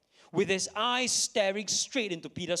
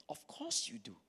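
soft inner reply; 3 semitones higher, 11 dB quieter than usual. Acted. Preacher voices a conscience answering Peter's lie, hushed.

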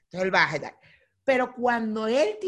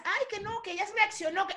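high, softer mocking voice; mimicking critics; 9 semitones higher, 5 dB quieter than usual. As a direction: imitate whiny naysayers up high.

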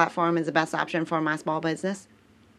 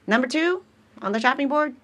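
game show host; 8 semitones higher, faster than usual. Pretend.

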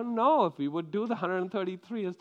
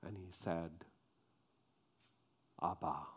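hushed sermon; 8 semitones lower, 6 dB quieter than usual. Acted. Preacher closing the Abraham story in a hushed voice. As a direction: draw them in with a low, quiet telling. Quiet reflective sermon close.

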